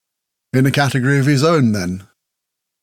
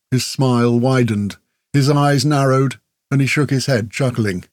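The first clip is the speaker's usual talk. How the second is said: usual speaking voice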